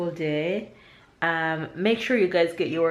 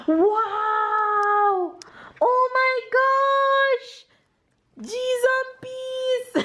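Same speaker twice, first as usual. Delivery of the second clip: excited praise; slower than usual. Real, not acted. Praising toddler's building.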